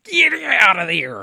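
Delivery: slow, high mock shout; gruff yell. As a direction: yell like a biker chasing kids. Leather daddy yelling at them.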